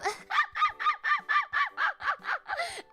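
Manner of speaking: high screaming cackle; losing it laughing; loud shrieking laugh. Real, not acted. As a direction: howl with laughter. Cracks up at own bit.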